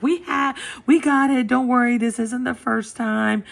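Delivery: loud, high vet tech taking charge; imitated reassurance. Voicing staff waving off the mess.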